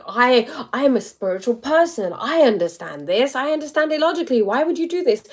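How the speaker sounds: emphatic, hammed-up spiritual superiority; self-righteous indignation. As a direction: act out a narcissist insisting they know better. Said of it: "narcissism coming out".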